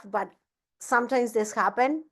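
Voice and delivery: ordinary talk, nothing marked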